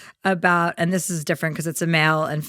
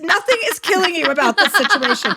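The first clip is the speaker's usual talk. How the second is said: exasperated rant; 10 semitones higher than usual. Real, not acted. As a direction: vent the disbelief. Ranting about the fan.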